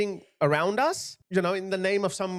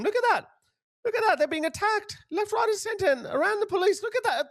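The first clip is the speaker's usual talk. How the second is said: much higher, urgent pointing-out; much higher, rising alarm at the footage; urgent, much higher voice over the clip; agitated; 14 semitones higher than usual. Real, not acted.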